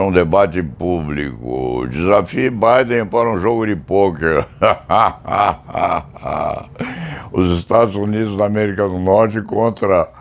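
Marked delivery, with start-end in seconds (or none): 4.5-6.0 string of ha-ha laughs; hearty laugh. Real, not acted. Amused at Putin challenging Biden to poker, laughing. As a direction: laugh out at your own joke.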